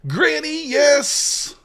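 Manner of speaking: high, loud whoop of triumph; victory cheer. Real, not acted. Streamer cheering his winning trade, a loud high whoop. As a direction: celebrate the win at full voice.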